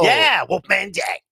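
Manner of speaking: drawn-out radio DJ growl-out of a name; Wolfman Jack. Acted. Friend shows him the old radio DJ, Wolfman Jack voice. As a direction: imitate a radio DJ, stretched out. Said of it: "that's how he sounded".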